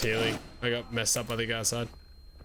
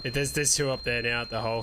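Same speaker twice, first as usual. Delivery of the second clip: ordinary delivery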